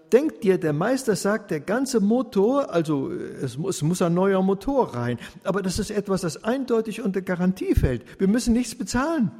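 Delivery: hoch und lauter, sprudelnd vor Freude; aufgeregt freudig. A narrator's reading. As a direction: erleichtert losplappern, die Stimme steigt. Elsa erzählt freudig die Nachricht. (Elsa berichtet freudig und aufgeregt von der Werkstatt)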